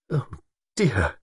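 halting, understated dismay; deep distress. A narrator's reading. Woman reacts to broken engagement, distress. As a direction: let the words fall, troubled. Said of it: "deep distress in her voice".

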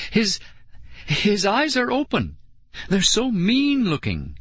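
high, halting, alarmed voice; frightened awe. A narrator's reading. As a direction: stammer the start, pitched high in alarm. Frightened girl staring at monster.

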